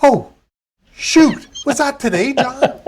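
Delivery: high-pitched "oops, forgot you" act; forgetful trucking company owner. Acted. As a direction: play an owner caught out by a forgotten meeting. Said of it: "trucking company owner looks at you and goes".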